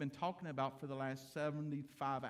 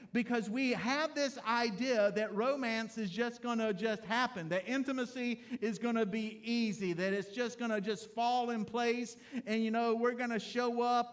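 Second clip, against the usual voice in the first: louder, driving sermon delivery; preaching at full force; 6 semitones higher, 7 dB louder than usual. Acted. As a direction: preach it out to the men in the pews. Preaching to congregation.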